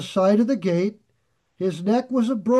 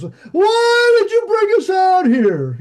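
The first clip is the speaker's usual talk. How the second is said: much higher and louder, slow whiny yell; complaining shout; 12 semitones higher, 7 dB louder, slower than usual. Retold or imitated. Grumbling Israelites shouting at Moses, a slow high complaining yell. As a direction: whine it out like a grumbling crowd. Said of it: "they were constantly murmuring".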